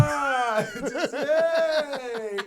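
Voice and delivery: high, drawn-out gawping; giddy wonder. Retold or imitated. Tripping guest gawping at the castle, giddy wonder. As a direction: marvel in a high, softly stretched voice.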